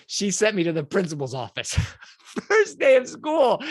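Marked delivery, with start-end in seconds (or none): 1.7-3.6 laughing it out slowly, louder and higher; amused, laughing disbelief at his first day. Real, not acted. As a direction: chuckle through the words, drawn out. Laughing at his own story.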